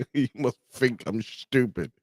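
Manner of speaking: mock British accent between laughs. Streamer repeating the meme line amused, British accent. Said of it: "British people be like".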